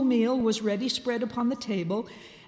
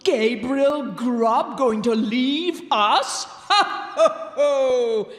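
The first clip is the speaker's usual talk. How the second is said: slow, louder cackling goblin taunt; a goblin jeering then laughing; 2 semitones higher, 6 dB louder, slower than usual. A narrator's reading. Goblin mocking the sexton with a cackle. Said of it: "said the goblin".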